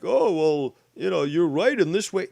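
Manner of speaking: mocking singsong; mocking quote. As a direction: mimic the wishy-washy view scornfully. Mocking opposing view.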